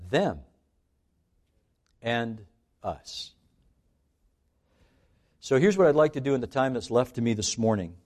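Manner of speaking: ordinary delivery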